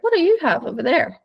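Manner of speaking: high, sing-song curiosity; mock nosy question. Voicing the nosy female dog.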